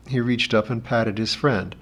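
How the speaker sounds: plain reading voice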